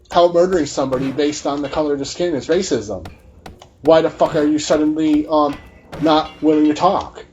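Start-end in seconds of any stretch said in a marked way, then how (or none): none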